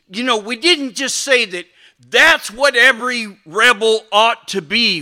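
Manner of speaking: ordinary talk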